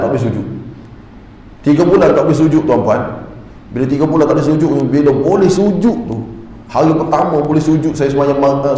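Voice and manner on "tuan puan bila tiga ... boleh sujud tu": ordinary delivery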